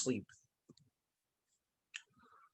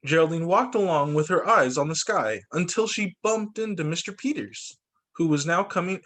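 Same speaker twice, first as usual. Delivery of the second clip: ordinary delivery, nothing marked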